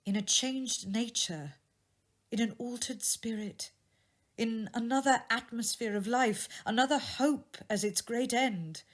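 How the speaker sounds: put-on young woman's voice. A narrator's reading. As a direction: speak as the jilted sweetheart, hurt but composed. Belle voiced by narrator.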